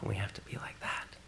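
whispered realization; hushed whisper. Retold or imitated. Disciples staring at a child, whispered awe. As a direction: say it under your breath in wonder.

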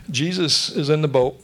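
ordinary delivery

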